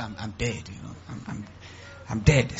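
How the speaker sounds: very soft, low mock woe; self-pitying. Church member angling for a loan, mock self-pity. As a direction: sink into feigned helplessness. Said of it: "because you want self-pity".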